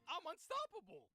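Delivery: high mock-triumphant boast; smug Higuchi. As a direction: crow it like a cartoon villain. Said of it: "and is like".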